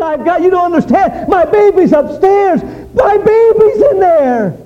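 high, loud frantic pleading; desperate pleading. A narrator's reading. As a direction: beg the fireman in panic. Mother pleads at burning house.

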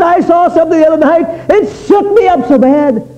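very high, loud wail of a scared lad; shaken, tearful cry. Retold or imitated. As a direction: act out bawling from being badly shaken. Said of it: "just literally bawled and bawled and bawled".

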